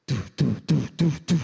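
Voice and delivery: very loud, heavy thudding noises. Retold or imitated. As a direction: pound out each imitated thud at full volume. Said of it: "I hear a louder noise".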